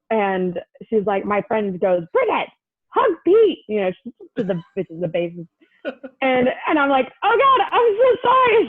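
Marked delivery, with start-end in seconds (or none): 2.1-3.6 high, insistent mock call; friend's urgent prompt. Friend telling her to hug the bassist, urgent high call. 7.2-8.7 very high, louder mock wail; mortified apology. Fan apologizing to the snubbed bassist, high mortified wail.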